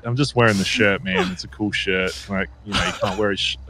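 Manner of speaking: ordinary delivery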